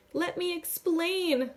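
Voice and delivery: high, slow desperate shout. A narrator's reading. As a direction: shout to be heard over her. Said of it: "I shout".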